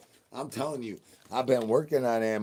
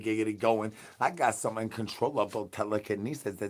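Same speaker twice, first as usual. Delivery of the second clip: ordinary talk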